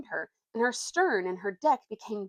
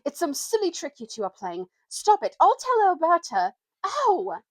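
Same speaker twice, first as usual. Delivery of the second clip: high, loud squealing panic; scared boy yelling in a tantrum; squeaky frightened temper; 9 semitones higher, 7 dB louder than usual. A narrator's reading. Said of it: "squeaky with fright and bad temper".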